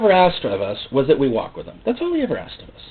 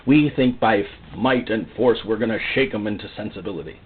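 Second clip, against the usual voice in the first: ordinary delivery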